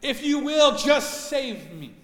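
loud pleading cry to God; desperate prayer. Retold or imitated. Man on flooded roof begging God, loud plea. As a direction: beg the Lord, slowing at the end. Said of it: "So he starts praying".

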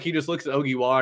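ordinary talk

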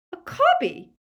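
very high, loud shriek of disbelief; incredulous screech. A narrator's reading. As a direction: screech it as an outraged question. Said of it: "screeched Bellatrix".

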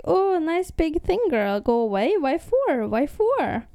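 high, sing-song little creature voice; preserver creature. Acted. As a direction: imitate a chirpy preserver with swooping questions. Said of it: "one of the preservers, uh, is like".

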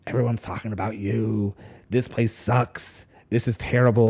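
the voice in your head turning on everyone; paranoid inner voice. Retold or imitated. The psychotic voice in his head, soft and dark. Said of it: "It's like".

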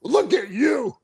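high, excited mock shout; freaking out. Wrestler seeing himself on the monitor, excited shout. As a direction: call it out, thrilled at yourself. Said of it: "And I'm freaking out, going".

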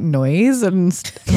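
ordinary talk

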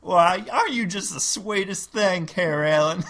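high, loud Southern belle; Southern lady voice. Acted. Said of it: "role play Carolyn and another overlord".

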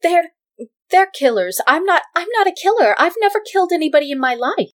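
high, loud frantic protest; pleading denial. Acted. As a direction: insist you're innocent, panicked. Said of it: "approaches you and says".

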